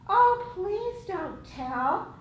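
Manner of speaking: pleading adult. Acted. Teacher acts a pleading adult.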